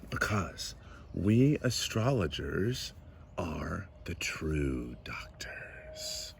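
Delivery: soft, low, slowing to a near whisper; hushed solemn claim. Acted. Astrologer declaring his kind true doctors, hushed and solemn. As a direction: let it sink into a grand, drawn-out whisper.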